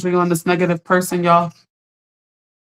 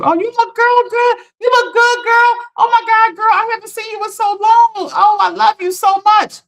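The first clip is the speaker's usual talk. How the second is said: high, bubbly gushing voice, much higher than her own; gushing friend; 11 semitones higher than usual. Retold or imitated. Mimicking the positive people greeting you, high gushing voice. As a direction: play the overly sweet friend who adores you. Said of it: "Ninety-nine people could be very positive towards you".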